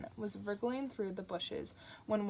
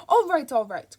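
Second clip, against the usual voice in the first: loud, higher, irritated snap; snapped; 5 semitones higher, 15 dB louder than usual. Acted. Crowley cutting off the angel's praise with a loud snap. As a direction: cut him off sharply. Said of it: "Crowley snapped".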